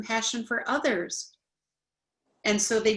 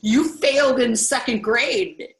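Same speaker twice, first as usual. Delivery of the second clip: loud, high defensive snap-back; triggered listener lashing back at criticism, loud; defensive retort; 4 semitones higher, 7 dB louder than usual. Shown as a demonstration. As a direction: hit back at the critic, stung. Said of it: "So you're gonna be like".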